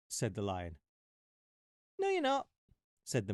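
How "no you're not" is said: very high insistent squeak; very high stubborn mouse retort; shrill defiant mouse. Acted. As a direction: top the argument with a piercing squeak. Mouse argues with lion.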